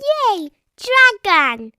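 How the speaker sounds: high, loud gleeful yell; excited cry. Real, not acted. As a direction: cheer for the dragon. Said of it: "cried George".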